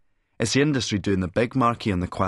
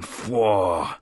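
lusty exclamation. Acted. Cannot resist an admiring exclamation.

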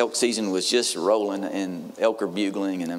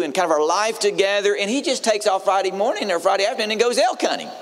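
imitating a hurt, wound-up bride, pitched much higher; upset young bride; 9 semitones higher than usual. Pastor mimics the bride telling her fiance's elk trip, high and aggrieved.

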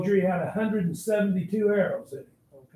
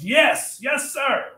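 exasperated, higher-pitched reply; beside himself; 6 semitones higher, 4 dB louder, much slower than usual. Acted. Fetterman called back by his colonel, exasperated. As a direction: answer the colonel at the end of your patience. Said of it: "Fetterman's just beside himself".

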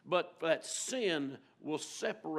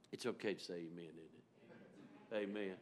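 soft coaxing aside; 7 semitones lower, 12 dB quieter than usual. Real, not acted. Coaxing congregation softly.

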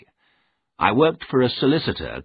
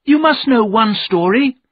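put-on high, loud pleading child; eager young cry; crying out eagerly; 8 dB louder than usual. A narrator's reading. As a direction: beg Father loudly for a story. Children begging for ghost stories.

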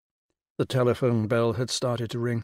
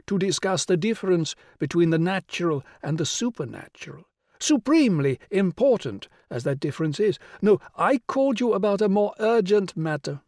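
grand regal lady, pitched high and louder; rich royal voice; 6 semitones higher, 3 dB louder than usual. Acted. The Rani greeting Will on the phone, rich and regal. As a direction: sound like a queen condescending down the phone. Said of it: "the rich royal voice".

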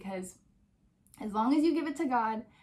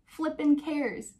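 higher and slow, each word punched; emphatic dismissal; 6 semitones higher, 5 dB louder, much slower than usual. Real, not acted. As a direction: drive home that it doesn't matter. Dismissing perfect-birth expectations.

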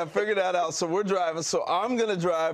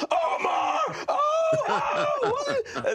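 very high-pitched drawn-out scream; panicked yelling; much slower than usual. Retold or imitated. Waking passenger screams at his driving roommate, panicked yelling. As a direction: wail the name in hammed-up terror, far above normal pitch. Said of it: "And I start yelling".

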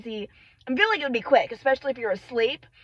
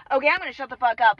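ordinary talk, nothing marked

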